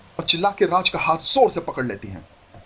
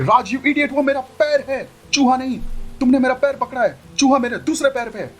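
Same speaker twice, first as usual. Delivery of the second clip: high, exasperated scolding; irritated young woman voice; annoyed young bride; 9 semitones higher than usual. Acted. Sonia voiced higher than narrator.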